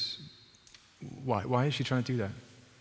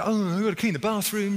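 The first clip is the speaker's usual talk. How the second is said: flustered Martha; 8 semitones higher, 3 dB louder than usual. Retold or imitated.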